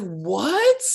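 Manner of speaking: stretched "what" with a questioning rise; incredulous. Retold or imitated. Reviewer retelling disbelief at the leverage twist, incredulous. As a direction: let it climb in retold disbelief. Retold disbelief at plot contradiction.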